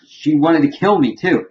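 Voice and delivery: high-pitched aggrieved protest; acted indignation. Retelling a character's grievance about nearly being killed, aggrieved. Gendry's complaint retold in acted quarrel.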